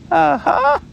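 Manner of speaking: anxious Piglet aha. Acted. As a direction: squeak it anxiously. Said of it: "said Piglet again".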